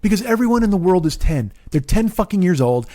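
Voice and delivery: ordinary talk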